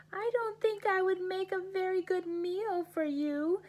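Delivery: high, small pleading goat voice; a tiny goat talking its way out of trouble; little goat voice. Acted. Little goat begs the troll to wait for his brother, high and pleading.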